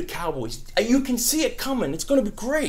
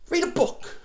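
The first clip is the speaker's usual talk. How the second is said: mock sneer; 6 dB quieter than usual.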